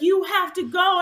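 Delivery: high, loud shouted urging; urgent shout. Retold or imitated. Race volunteer hurrying a late runner, high urgent shout. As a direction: yell at her to hurry before the cutoff.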